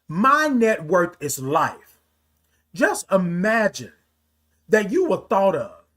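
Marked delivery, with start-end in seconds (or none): 0.1-2.0 slow, fuller-voiced declaration; a little loud. Real, not acted. Speaker proclaiming to viewers what they are worth, a little loud. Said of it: "get a little loud here".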